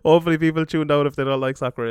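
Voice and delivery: usual speaking voice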